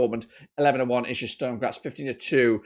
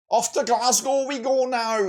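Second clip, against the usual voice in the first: put-on a slow, high stab at a Glasgow accent, unsure which accent it is; odd put-on accent; 9 semitones higher, slower than usual. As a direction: put on a shaky mock Glasgow accent, much higher and slow. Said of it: "I don't even know what accent that was".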